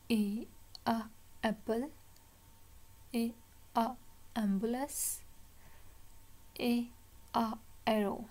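"A", pause, short "a", pause, then "apple"; letter, sound, word. Shown as a demonstration. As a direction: sound out "A, a, apple" with gaps, likewise "ambulance" and "arrow". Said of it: "the first sound is a". ("A, a, apple" रुक-रुककर, अक्षर फिर ध्वनि)

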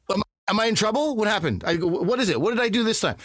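high, anxious mock panic; mock worry. Employee answering his boss's call, worried and high-pitched.

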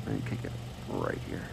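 ordinary talk

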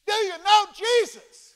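loud, high, slow shout over plane noise; shouting over engine. Retold or imitated. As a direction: yell it over the roaring engine. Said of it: "See, it's loud in there".

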